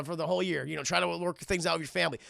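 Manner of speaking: ordinary talk, nothing marked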